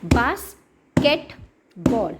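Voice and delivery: slow, one tap per syllable: बास्केट-बॉल split into beats. Shown as a demonstration. Teacher modelling syllable tapping for parents, slow and segmented. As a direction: stretch बास्केटबॉल out beat by beat, very slowly. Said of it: "मैं अभी आपको tapping करके बताती हूँ".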